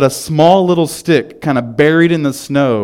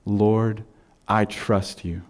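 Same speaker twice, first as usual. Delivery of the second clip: soft, hushed and lower; quiet prayer; 4 semitones lower, 8 dB quieter, slower than usual. Shown as a demonstration. Pastor showing the congregation how to pray, softly. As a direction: breathe it to God like a private plea. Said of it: "Do you ever say".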